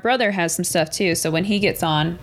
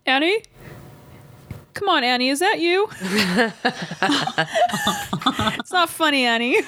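calling out; 5 semitones higher than usual. Pretend. Calling out to a ghost.

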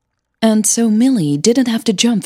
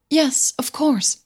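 shy Millie; 9 semitones higher, 3 dB quieter, slower than usual. Acted. Millie answers nervously.

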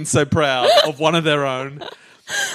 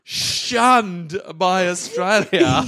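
slow dramatic punchline; 2 semitones higher, much slower than usual. Acted.

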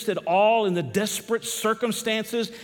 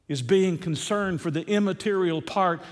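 ordinary talk

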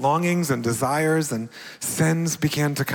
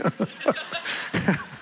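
airy laugh at himself; breathy high laugh; 10 semitones higher, 4 dB quieter than usual. Real, not acted. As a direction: laugh off the desperation. Laughs at his own desperation.

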